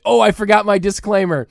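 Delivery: ordinary talk, nothing marked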